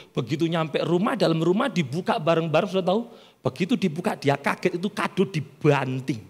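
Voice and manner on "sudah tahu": ordinary talk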